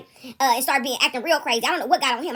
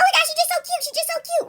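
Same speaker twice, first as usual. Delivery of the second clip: much higher gushing coo; cooing boss; 13 semitones higher than usual. Retold or imitated. Teller mimicking the head guy cooing over her, high mock coo. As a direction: gush like he's talking to a toddler. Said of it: "he's like".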